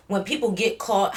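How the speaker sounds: ordinary delivery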